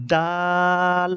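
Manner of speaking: দাল very slow, long level aa; demonstrated pronunciation. Shown as a demonstration. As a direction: stretch দাল on one steady note. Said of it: "এভাবে উচ্চারণ করতে হবে". (দাল লম্বা করে টেনে)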